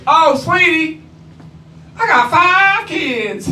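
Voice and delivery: high, slow sweet brush-off; mock sweet letdown; waitress letting him down. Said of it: "she goes".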